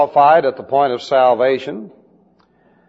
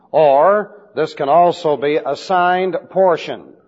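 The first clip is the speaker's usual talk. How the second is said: ordinary talk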